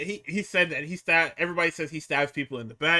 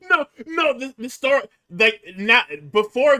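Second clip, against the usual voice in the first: high, loud, stumbling protest; excited objection; 8 semitones higher, 7 dB louder than usual. Real, not acted. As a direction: jump in loudly to correct. Excitedly objecting over the laughter.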